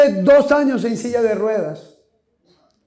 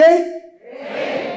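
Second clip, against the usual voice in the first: call for answer; 5 semitones higher than usual. Shown as a demonstration. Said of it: "¿No tienen qué?".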